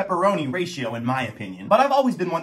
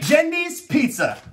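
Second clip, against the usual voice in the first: announcer call; 8 semitones higher, slower than usual. Real, not acted. Announcing first contender.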